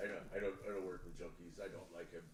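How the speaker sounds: hushed, low stammering impression; Johnny Depp. Actor refusing a co-star to a director, mock Johnny Depp mumble. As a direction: falter under the breath, deep and soft. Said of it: "I'm like".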